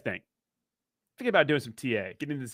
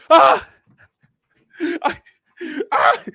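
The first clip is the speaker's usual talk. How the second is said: shrill fake scream, much higher and louder; mock panic scream; 13 semitones higher, 9 dB louder, much slower than usual. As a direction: scream like a panicking flight attendant. Panicking flight attendant screams.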